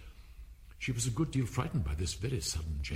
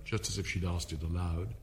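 ordinary delivery, nothing marked

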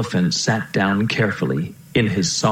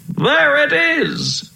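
high, drawn-out shout of discovery; excited cry; much slower than usual. A narrator's reading. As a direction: call it out from up high. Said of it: "he cried".

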